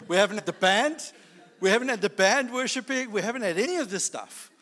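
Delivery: incredulous protest. Retold or imitated. Preacher protesting to the Lord that nothing is ready, incredulous. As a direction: protest in disbelief, pitch climbing.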